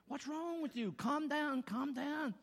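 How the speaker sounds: a hint of Saint Peter soothing; calming Peter. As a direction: ease him down, lightly voiced. Peter calming the angry man.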